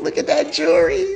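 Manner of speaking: admiring high voice. Real, not acted.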